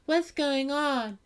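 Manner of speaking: slow, high, dozy question; sleepy high voice. Retold or imitated. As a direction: ask it half asleep. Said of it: "she's like".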